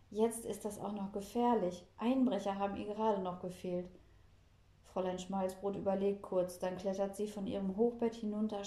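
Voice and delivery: ordinary delivery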